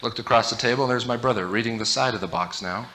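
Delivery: ordinary talk, nothing marked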